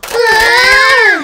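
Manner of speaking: high, loud scream as she falls; falling scream. Acted. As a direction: scream while tumbling down. Falls from the tree.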